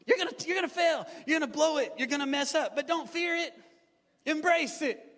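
high-pitched, punchy sermon refrain; rousing preaching. Real, not acted. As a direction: drive each short phrase home to the congregation.

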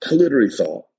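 ordinary talk

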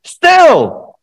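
sudden high, very loud yell; emphatic shout. Shown as a demonstration. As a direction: shout it to jolt the crowd awake. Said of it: "That's emphatic".

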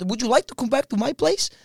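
put-on Norwegian accent. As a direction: imitate a Norwegian inviting someone over. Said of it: "do a Norwegian accent".

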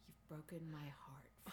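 hushed, low movie quote, nearly whispered; heartbroken anger. Acted. Quoting Michael Corleone to his brother, hushed heartbroken anger. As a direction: quietly quote it as a wounded betrayal. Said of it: "He is so heartbroken, and he's so angry".

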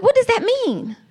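put-on high, puzzled little-girl voice; child's confused question. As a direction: ask it like a bewildered small child. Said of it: "I was like".

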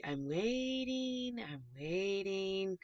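slow, stretched-out elephant moan; drawn-out waiting. Acted. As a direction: drag each word out in long-suffering impatience. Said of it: "series of emotions that this poor little elephant".

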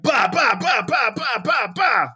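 high, punchy string of imitated thuds; imitated rapid-fire throwing. Mimicking clothes thrown out of the house, rapid-fire noise. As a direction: fire off each syllable in a high, even burst. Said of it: "it's like".

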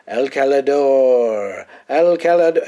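slow, swelling anguished cry of the homeland; dramatic lament. Retold or imitated. Vampire Nandor mourning his homeland, mock anguish. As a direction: wail the name like a grieving vampire.

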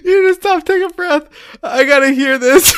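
high, laugh-strained urging; laughing plea. Real, not acted. Friend begging the giggling teller to breathe, laughing plea. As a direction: beg the storyteller through your laughter.

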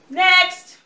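shouted next; loud call. Acted. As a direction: yell it across the set. Director calling next actor.

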